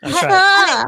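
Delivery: high, swallowed words; mock swallowed throat voice; producer Eric voice. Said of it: "producer Eric imitation".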